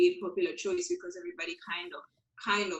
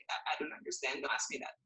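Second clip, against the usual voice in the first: whispered, much softer helpless reply; timid whisper; 6 semitones lower, 11 dB quieter, faster than usual. Retold or imitated. Newly arrived kid who can't answer in English, timid whisper. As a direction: breathe it out like a lost newcomer. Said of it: "I would be like".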